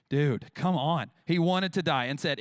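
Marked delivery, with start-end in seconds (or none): 0.1-1.1 exasperated. Real, not acted. Pastor exasperated at Jonah.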